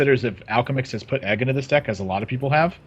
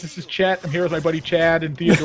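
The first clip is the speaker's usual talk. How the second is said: lightly voiced dudebro YouTuber intro; bro gamer voice; 8 semitones higher than usual. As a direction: greet viewers like a bro streamer, higher. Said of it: "I wanna see that guy make a guide".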